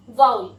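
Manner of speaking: वाउल said as "vaul", rhyming with owl; वाउल, one syllable like "vowel" without the e; correct pronunciation. Shown as a demonstration. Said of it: "इसका सही pronunciation होता है वाउल".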